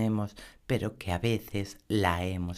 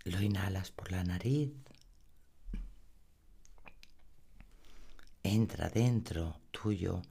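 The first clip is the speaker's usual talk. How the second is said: guided meditation; 3 dB quieter than usual. Acted. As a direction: lull the listener into calm. (voz suave de meditación guiada)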